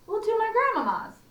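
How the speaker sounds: put-on high, bright girl's answer; little girl voice. Little Red answering the wolf in a high girlish voice. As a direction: pitch it up, cheerful little girl.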